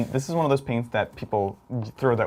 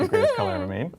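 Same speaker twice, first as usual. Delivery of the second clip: gushing high voice; faster than usual. Pretend. Praising favourite paint playfully.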